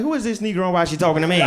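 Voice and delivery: loud, fast, indignant; confused old man. Retold or imitated. Comic Biden baffled by Kamala. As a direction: blurt it out like a baffled grandpa. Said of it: "He like".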